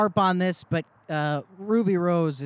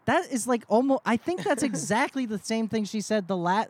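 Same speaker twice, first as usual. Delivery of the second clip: normal talking voice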